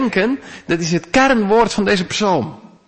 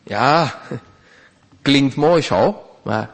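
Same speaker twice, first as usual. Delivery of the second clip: ordinary talk, nothing marked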